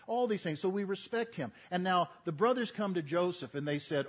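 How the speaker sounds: ordinary delivery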